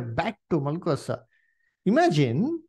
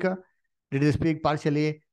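ordinary talk

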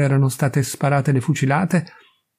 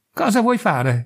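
a mild mock cry of alarm, pitched up; cried out; 6 semitones higher than usual. A narrator's reading. Edith cries out at Hans loading the gun, higher. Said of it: "gridò Edith".

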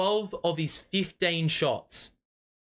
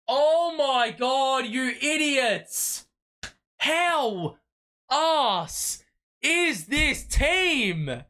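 furious yelling; 8 semitones higher, much slower than usual. Real, not acted. Fan screaming at his own team after the loss. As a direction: drag out each word in disgust.

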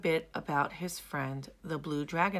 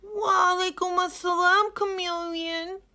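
put-on sad young hippo; 10 dB louder than usual. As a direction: answer with a heavy heart. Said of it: "replied Hilmi sadly".

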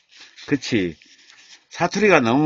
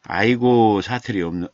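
normal talking voice

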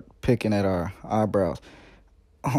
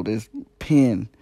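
normal talking voice

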